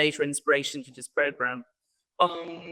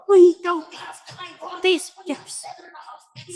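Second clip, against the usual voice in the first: impatient; 12 semitones higher than usual. Retold or imitated. Quoting impatient Shell executive.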